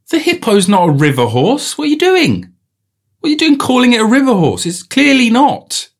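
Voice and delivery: high-pitched, incredulous protest; mock outrage. Objecting to calling it a river horse, mock outrage. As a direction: climb way up in comic indignation. Acted outrage at the word's origin.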